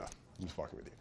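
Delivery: breathy teasing whisper, barely voiced; whispered aside. Real, not acted. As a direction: drop to a whisper to let them in on the joke. Aside after teasing answer.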